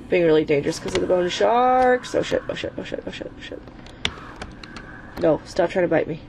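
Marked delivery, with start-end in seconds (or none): 2.1-3.6 whispered oh shit in real panic; panicked whisper. Real, not acted. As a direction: breathe it out in fear. Bone sharks attacking.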